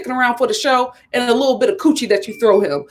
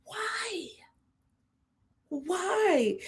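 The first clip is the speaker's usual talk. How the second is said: breathy whispered disbelief; whispered why; 6 semitones higher, 13 dB quieter than usual. Real, not acted. A stunned whisper at the chocolate brown blush. Exasperated reaction to dark blush.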